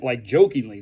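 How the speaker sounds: ordinary delivery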